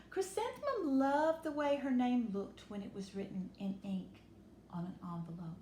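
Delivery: usual speaking voice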